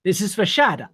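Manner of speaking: ordinary talk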